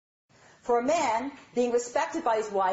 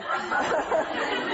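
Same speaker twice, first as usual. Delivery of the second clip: short breathy laugh; amused chuckle; 6 semitones higher than usual. Real, not acted. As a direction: burst out laughing mid-story. Laughs mid story.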